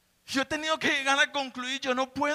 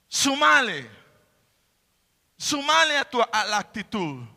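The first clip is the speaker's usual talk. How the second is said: building, louder exhortation; fiery preaching; 3 semitones higher, 4 dB louder than usual. Acted. Preacher urging congregation to add virtue, louder and building. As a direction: drive the exhortation home, rising.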